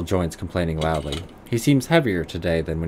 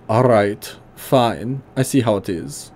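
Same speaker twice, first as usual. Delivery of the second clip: put-on gruff German accent, pitched higher; 4 semitones higher, 3 dB louder, slower than usual. Old tinkerer greeting the player in a higher gruff German accent. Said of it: "high German voice".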